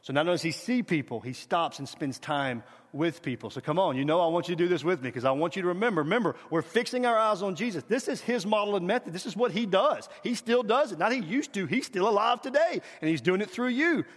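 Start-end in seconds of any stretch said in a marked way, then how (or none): none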